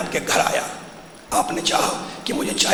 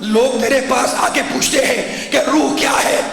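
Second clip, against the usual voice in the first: preaching at full force, building fervor; impassioned pulpit delivery, mounting to a peak; fervent preaching; 4 semitones higher than usual. Real, not acted. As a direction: drive the point home to the crowd. Preacher rises to fervent climax.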